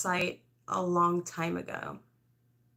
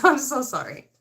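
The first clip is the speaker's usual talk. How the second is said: laughing apology; 6 semitones higher, 7 dB louder than usual. Real, not acted. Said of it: "apologizing, laughing".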